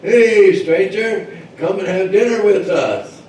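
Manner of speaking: high, loud hailing call; hollering. Acted. Villagers call a paddling stranger to dinner, hollering. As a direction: holler it out across the water. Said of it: "And he hollered".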